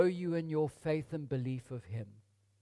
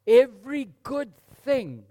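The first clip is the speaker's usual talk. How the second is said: 1 proclaiming scripture; 6 semitones higher, 10 dB louder than usual. Acted.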